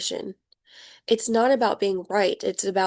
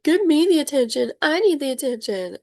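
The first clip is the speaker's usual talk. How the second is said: higher, a mock needy child; whiny baby; 6 semitones higher than usual. Childish person demanding attention from you, mock whiny. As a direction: beg for attention like a spoiled toddler. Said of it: "They're very much like".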